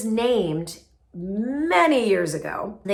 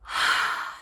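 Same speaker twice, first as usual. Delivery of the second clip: slow calming breath out; long audible exhale. Shown as a demonstration. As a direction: let out a deep sigh. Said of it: "Take a breath".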